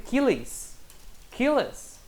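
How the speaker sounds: "killings" as "KEE-lees", "killers" as "KEE-les"; high-pitched "killings" and "killers" with an extra syllable; wrong forms shown. Shown as a demonstration. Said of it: "Então eu não posso escrever".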